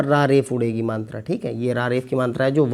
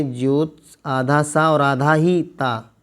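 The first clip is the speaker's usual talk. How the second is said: slow spelling out; much slower than usual. Shown as a demonstration. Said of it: "दोनों आधा ही बनेंगे".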